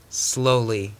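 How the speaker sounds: usual speaking voice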